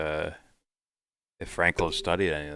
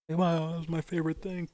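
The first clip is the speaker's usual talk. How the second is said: mock cheerful; 12 semitones higher, 4 dB quieter than usual. Sarcastic voiced aside.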